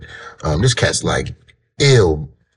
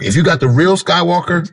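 pitched way up with enthusiasm; excited; 10 semitones higher than usual. Real, not acted. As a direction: rave about it, voice jumping high. Emphatic praise of strain.

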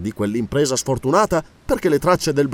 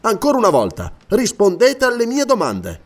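higher-pitched, pressing questioner; stern interrogator; 4 semitones higher than usual. Acted. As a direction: press the prisoner for an answer. (interrogatore incalzante)

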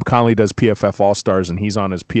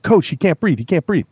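teammate's alarmed call; 6 semitones higher than usual. Retold or imitated. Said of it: "he's like".

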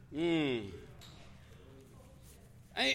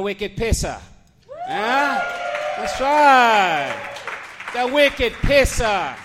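put-on loud, drawn-out New England accent; 4 semitones higher, 8 dB louder, much slower than usual. Host rousing the club crowd, shouted New England accent. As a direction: belt it out like a New England local. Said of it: "I love the New England accent".

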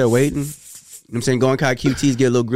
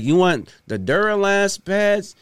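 imitating the clerk; 6 semitones higher, slower than usual. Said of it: "So he's like".

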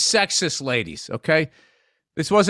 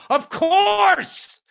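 retold yell; 12 semitones higher than usual. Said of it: "I just yelled out".

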